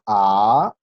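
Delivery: long, loud rising "A"; second tone. Shown as a demonstration. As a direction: draw out "A" as it climbs like a question. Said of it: "subir el tono de la voz de manera gradual".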